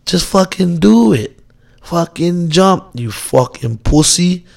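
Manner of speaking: slow jeering inner voice egging him on; taunting inner voice. Retold or imitated. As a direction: drag out the dare like a mocking devil on your shoulder. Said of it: "And the voice of man's like".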